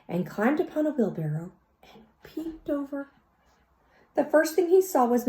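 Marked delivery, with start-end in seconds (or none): 0.1-3.1 whisper. A narrator's reading. Peter sneaking, peeping.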